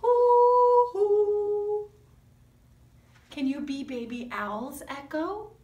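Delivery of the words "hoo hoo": high, loud hooting; sung owl hoo for the children. Acted. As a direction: hoot it like a baby owl. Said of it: "your baby owl voices ready".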